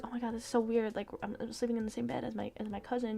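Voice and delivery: low, quiet, awkward murmur; nervous. Acted. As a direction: imitate someone weirded out, muttering uneasily to herself. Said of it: "she's, like, getting nervous".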